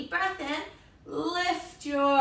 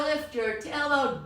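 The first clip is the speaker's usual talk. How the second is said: ordinary talk, nothing marked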